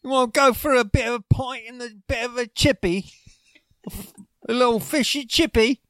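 put-on British accent. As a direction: shout it like a cartoon Englishman. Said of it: "if you go to London".

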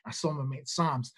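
ordinary talk, nothing marked

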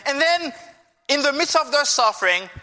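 ordinary delivery

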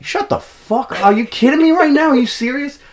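very high-pitched, squeaky disbelief; shriek-like mock outrage at full tilt; incredulous outburst. Storyteller acts out confronting girlfriend at bar, incredulous outburst.

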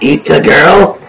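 joking yell back. Acted. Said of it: "I will yell back".

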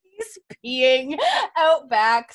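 high, loud, laughing reveal. Real, not acted. Recapper reveals the hero's activity to co-host, laughing. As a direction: break up laughing as you tell it. Cracks up telling the scene.

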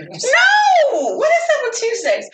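mock fury at the pushy texter; exasperated telling-off. Woman refusing a man who wants her out on a Tuesday, mock outrage.

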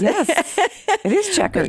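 high, playful agreement; playful high yes. Pretend. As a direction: chirp it on cue, playfully. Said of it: "you're supposed to be like, "Yes".

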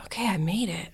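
soft whispered relief; relieved whisper. Retold or imitated. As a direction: whisper it like slipping away unnoticed. Sneaking out of theatre.

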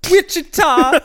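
high, laughing proclamation; grand announcement. Pretend. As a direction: announce it like a big reveal. Grand announcement of fake capital.

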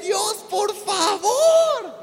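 slow, high, loud pleading to God; desperate plea. Retold or imitated. Preacher retelling his plea to God amid waves, slow loud begging. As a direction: beg for air.

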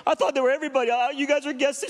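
high, loud tearful wail, imitated; emotional outburst. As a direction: wail it as the overwrought cleaning lady. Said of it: "I'm sorry to be emotional".

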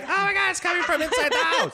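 high laughing horror-movie gasp; hammed-up high panic, laughing; mock panic. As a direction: squeal it like a scream queen line. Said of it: "I'm like".

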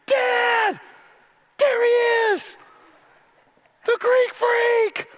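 high-pitched, loud, drawn-out shout of pure delight; excited kid shout. Retold or imitated. A boy yelling to his dad as the star appears, high and loud. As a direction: yell it like a kid seeing his hero. Sons spot their hero.

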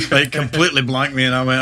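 usual speaking voice

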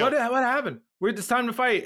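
high, agitated crowd voice; mock screaming. Ambassadors clamour for war, mock high-pitched agitation. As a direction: imitate an uproar without truly screaming. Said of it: "everyone's screaming".

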